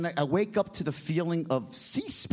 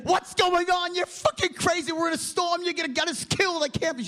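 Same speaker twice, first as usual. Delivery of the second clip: panicked wife; 12 semitones higher than usual. Retold or imitated. Wife panicking at her husband during the storm, high mock panic. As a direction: squeal it like a frantic wife scolding her husband. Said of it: "She's freaking out with his big blue eyes".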